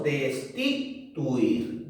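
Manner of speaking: "Destituir" slowly broken before its last syllable, tuir; finding the stress. Shown as a demonstration. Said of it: "Dónde está la mayor fuerza de voz". ("Destituir" lento y por partes, buscando la sílaba tónica)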